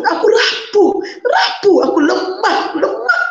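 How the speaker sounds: high, breaking cry of despair. Real, not acted. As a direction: let the voice crack on rapuh. Breaks down admitting weakness.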